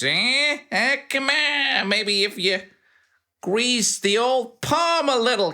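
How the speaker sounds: a high, wheedling huckster demanding a bribe; a greedy informant angling for coins; Bruno haggling. Acted. Bruno pesters the player for silver, in a high wheedling voice.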